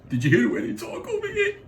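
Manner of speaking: put-on a twelve-year-old shocked by new sounds; high, amazed kid. Kid marveling at Boston accent.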